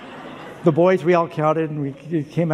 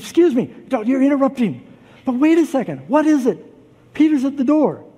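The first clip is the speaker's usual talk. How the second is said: excited interruption; 10 semitones higher, 6 dB louder than usual. Acted. Servant girl bursts in excitedly.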